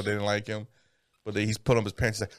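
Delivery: ordinary delivery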